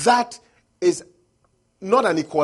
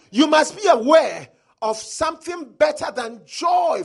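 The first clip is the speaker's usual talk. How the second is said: preaching shout; 7 semitones higher, 6 dB louder than usual. Acted. Preacher driving home his point.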